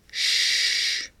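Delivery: a breathy shush under her breath; hush. Acted. Vicky hushing Denver so the men above don't hear, a soft shush. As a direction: hush him so the people upstairs can't hear. Hushing him, people upstairs.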